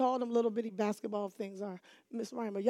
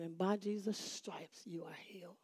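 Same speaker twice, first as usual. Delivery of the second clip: hushed whisper; 4 semitones lower, 13 dB quieter than usual. Retold or imitated. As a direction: breathe the promise out gently. Retelling a blessing spoken hushed.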